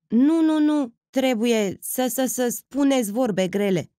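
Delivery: halting, slow, repeated stammer. A narrator's reading. Old Costache stammers a reproach at Aglaie. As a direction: stumble over the words nervously. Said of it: "se bâlbâi el".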